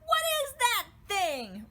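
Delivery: high, loud frightened cry; panicked question while fleeing; alarmed. Acted. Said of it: "Royce asked".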